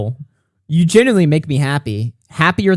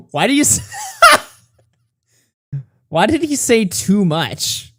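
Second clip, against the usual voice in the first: laughing question; 8 semitones higher than usual. Real, not acted. Puzzled by the DM, asking through laughter. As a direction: ask while still giggling.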